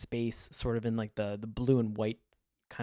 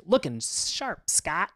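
slow, higher sing-song tease; teasing sister; 5 semitones higher, 3 dB louder, much slower than usual. Retold or imitated. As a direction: drag it out playfully. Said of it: "the sister at the end just saying".

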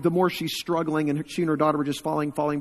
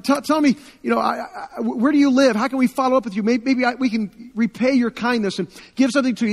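eager gratitude; 7 semitones higher, 4 dB louder than usual. Retold or imitated. Refugee begging to repay kindness.

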